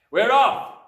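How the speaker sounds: loud, high-pushed brusque dad; gruffly impatient father, very loud. A narrator's reading. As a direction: announce it at a shout. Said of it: "Mister Wormwood said".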